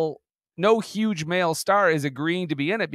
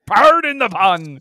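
loud, drawn-out and higher; mock exclamation; 4 semitones higher, 8 dB louder, slower than usual. Co-host hoots at the pun, loud mock exclamation. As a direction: blow up the phrase in mock outrage. Mockingly repeats the apologised-for pun.

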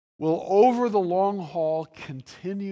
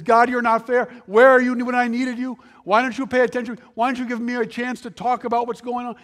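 loud, raised, pleading protest; anguished complaint; 5 semitones higher, 6 dB louder, faster than usual. Acted. Job shouting his complaint up at God, loud and high. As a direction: cry out at God, voice high and loud, the last line fast. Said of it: "and he screams".

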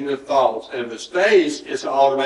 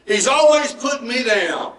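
higher, wounded protest; hurt complaint; 6 semitones higher than usual. Acted. Someone whose feelings got hurt, complaining. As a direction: complain as if put upon, pitched up. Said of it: "something that hurt your feelings".

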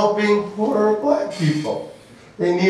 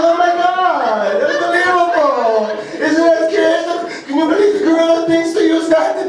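put-on excited Russian girlfriend; 8 semitones higher than usual. Girlfriend raving about a gorilla video, excited mock Russian accent. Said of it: "And she's like".

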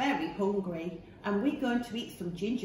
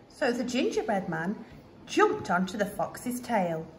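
plain reading voice

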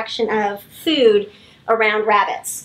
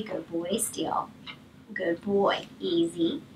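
soft calming praise; 7 semitones lower, 11 dB quieter, slower than usual. Real, not acted. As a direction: settle the dog gently.

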